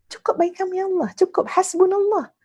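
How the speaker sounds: high-pitched, sure declaration; joyful, firm conviction. Shown as a demonstration. Ustazah modelling trust in Allah, joyful and firm. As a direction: affirm it gladly, without doubt. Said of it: "dengan gembira, dengan teguh".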